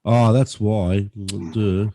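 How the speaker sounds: ordinary delivery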